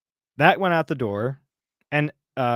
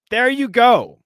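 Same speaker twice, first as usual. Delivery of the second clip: high, loud "there you go!"; loud, high-pitched vindication; emphatic exclamation; 9 semitones higher, 6 dB louder, slower than usual. Real, not acted. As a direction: exclaim it as if proven right.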